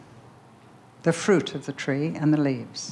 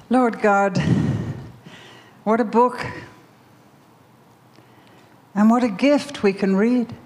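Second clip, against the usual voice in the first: praying aloud to God, a touch raised and fervent; prayer; 3 semitones higher than usual. Acted. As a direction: pray out with fervour. Opens a prayer.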